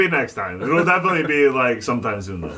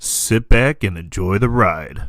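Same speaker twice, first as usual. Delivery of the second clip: low, slow, loud announcer read; deep slow show-opener voice; announcer intro; 6 semitones lower, 6 dB louder, slower than usual. Acted. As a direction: boom it out like a radio intro.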